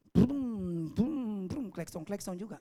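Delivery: engine revving. Retold or imitated. Neighbour's new motorbike revving, imitated.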